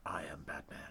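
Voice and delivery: low, soft mock Batman growl. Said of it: "putting on a funny voice".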